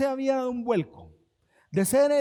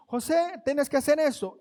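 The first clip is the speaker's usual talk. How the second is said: orden del amo; 6 semitones higher, 3 dB louder than usual. Acted. Amo dando órdenes a José.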